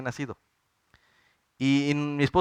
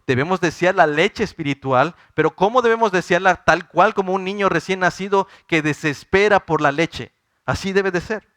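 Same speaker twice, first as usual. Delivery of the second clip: fervent preaching; 4 semitones higher, 5 dB louder than usual. Acted. Preacher at full force.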